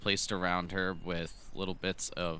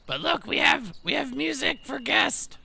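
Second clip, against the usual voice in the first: goblin voice; 4 dB louder than usual. Acted. Goblins offer music to guests.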